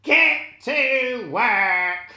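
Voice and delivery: loud, slow witch command; witch ordering. Acted. The witch ordering the prisoners to work, loud and slow. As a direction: bark the order at your prisoners.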